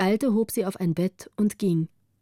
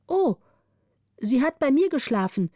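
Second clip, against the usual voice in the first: put-on high, eager little girl; bursting to tell the others; excited child's call; 6 semitones higher than usual. The orphan calls out to the waking children, excited and high. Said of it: "rief die Waise".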